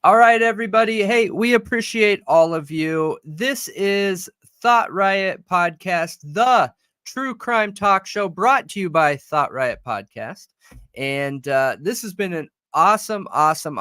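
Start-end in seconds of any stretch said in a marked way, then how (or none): 0.0-10.5 upbeat host sign-off; podcast outro. Acted. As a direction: announce the show brightly.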